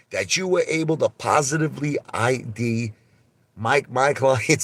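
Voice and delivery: incredulous lawyer impersonation. Retold or imitated. Lawyer challenging witness's ID of his clients, mock incredulous. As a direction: finish the mock cross-examination high and emphatic. Lawyer's cross-examination resumes after aside.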